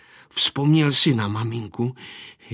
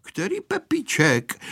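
fully voiced, higher question; asking aloud; 7 semitones higher than usual. A narrator's reading. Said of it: "zeptal se nahlas". (otázka položená nahlas)

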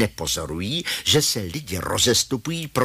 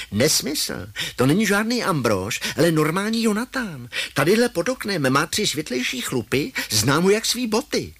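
put-on higher-pitched boy's voice; insistent little boy; 6 semitones higher than usual. A narrator's reading. Substitute Mach insists the dog is Jonatán, high boyish voice. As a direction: contradict them in a high kid voice.

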